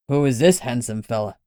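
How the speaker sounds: put-on curious, easy-going old man explaining; a wily older man, unbothered. Lenny to the dog.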